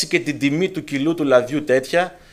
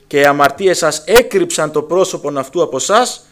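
fervent preacher's charge; preaching at full force; 7 dB louder than usual. Acted. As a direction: proclaim it like a trumpet. Preaching the prophecy loudly.

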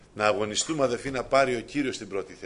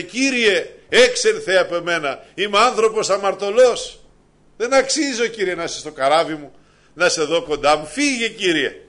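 much higher and louder, pleading; fervent preaching; 8 semitones higher, 10 dB louder than usual. Acted. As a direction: cry out as Peter begging the Lord. Preacher voicing Peter's plea.

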